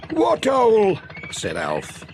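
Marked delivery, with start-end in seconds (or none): 0.1-1.0 put-on high, puzzled old farmer; a raised, bewildered yokel voice; Alf. Farmer surprised by Pat's news, in a high puzzled voice.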